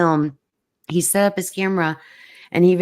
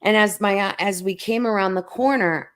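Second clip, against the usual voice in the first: usual speaking voice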